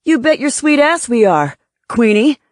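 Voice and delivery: loud, high-pitched bluster with swooping pitch; angry retort. A narrator's reading. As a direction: snap back loudly as an offended boss. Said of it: "He blanched angrily".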